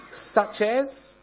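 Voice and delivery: high, doubtful question; sceptical. Acted. Corrie, unconvinced in the foul room, asks Betsy high and sceptically. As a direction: challenge her, unconvinced. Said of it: "I said".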